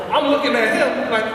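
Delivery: normal talking voice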